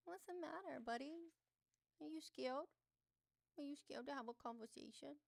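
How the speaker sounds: high, singsong mocking taunt. Streamer taunting a viewer who won't join, singsong mockery. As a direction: tease him like a scared kid.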